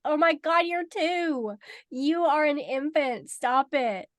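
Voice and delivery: high, loud cooing disbelief; mock exclaiming. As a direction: exclaim at the baby who claims to be grown. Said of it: "And you're like".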